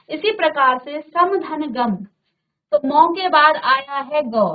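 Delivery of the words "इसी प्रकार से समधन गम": usual speaking voice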